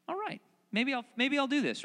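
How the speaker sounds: lightly voiced David, thinking it over; hesitant inner voice weighing the choice; musing David. David mulling over the king's offer, musing.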